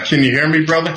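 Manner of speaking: thin, boxy speakerphone sound. Real, not acted. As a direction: talk over a speakerphone. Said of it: "it sounds like, uh, you're on a speakerphone".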